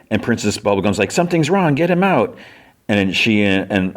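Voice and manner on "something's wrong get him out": high-pitched, urgent mock alarm; retold alarm. Princess Bubblegum orders Finn pulled from the coffin, mock alarm. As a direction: act out a princess raising the alarm.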